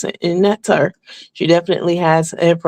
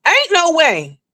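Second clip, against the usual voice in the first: still emphatic; 12 semitones higher, slower than usual. Real, not acted. Repeats the disbelief, coming down.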